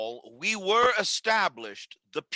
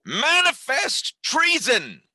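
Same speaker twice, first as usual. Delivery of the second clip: sharp, higher accusing cry; outraged cry; 7 semitones higher, 4 dB louder than usual. Acted. Outraged senator accuses Coriolanus.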